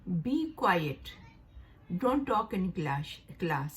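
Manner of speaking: teacher dictating a classroom command; slow example sentence. Shown as a demonstration. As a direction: read it slowly so learners can follow. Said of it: "दूसरा इसका जुमला है".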